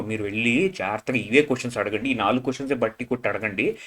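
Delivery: low, quiet confidential instructions; imitating a briefing. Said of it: "వీళ్ళందరికీ చెప్పి పంపిస్తారేమో". (రహస్యంగా సూచనలిస్తున్నట్టు తగ్గు గొంతుతో)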